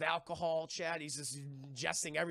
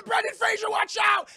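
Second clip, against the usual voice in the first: very high, loud screamed warning; wife's full-blast shriek of alarm; panicked warning; 14 dB louder than usual. Retold or imitated. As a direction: scream it as the crash is coming. Wife warns before truck crash.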